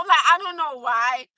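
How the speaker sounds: usual speaking voice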